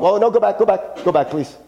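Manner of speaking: real sudden interruption, repeated urgently; flustered request. Real, not acted. Preacher to the slide operator, flustered.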